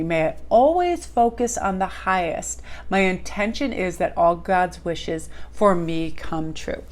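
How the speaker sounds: slow, soft prayer voice; prayerful affirmation. Acted. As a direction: say it slowly like a prayer. Speaks an affirmation.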